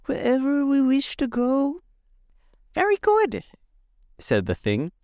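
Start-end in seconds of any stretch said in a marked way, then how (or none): 0.0-1.8 put-on much higher boyish voice, matter-of-fact; a young boy explaining plainly; high youthful voice; boy Tip. A narrator's reading. 2.7-3.4 very high, squeaky creature voice; Gump creature. A narrator's reading.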